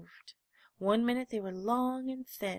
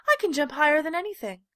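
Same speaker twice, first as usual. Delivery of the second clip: loud, quick, higher boast; 7 semitones higher, 11 dB louder, faster than usual. A narrator's reading. Little rabbit boasting to the wild rabbit, loud and quick. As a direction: brag it fast and proud. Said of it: "said the little rabbit".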